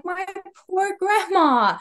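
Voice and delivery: high, slow sympathetic sigh of pity; tender pity. Retold or imitated. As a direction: feel sorry for her, gently. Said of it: "And I'm like".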